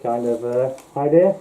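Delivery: talking through a full-face mask; muffled through mask. Real, not acted. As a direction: voice muffled behind a latex mask. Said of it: "my voice is gonna be muffled through the mask".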